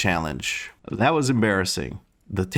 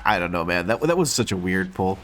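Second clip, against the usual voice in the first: usual speaking voice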